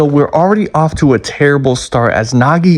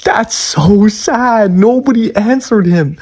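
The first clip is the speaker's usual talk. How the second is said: higher, very slow whine; mock sad whine; 8 semitones higher, slower than usual. Real, not acted. Reviewer pitying Nagi left outside all night, whiny. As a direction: drag it out in pity.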